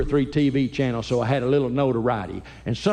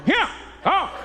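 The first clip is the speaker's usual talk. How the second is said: high, laughing command to bed; laughing mock dad order; 10 semitones higher than usual. Dad telling sons to go to their bedroom, laughing. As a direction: try to sound firm while laughing.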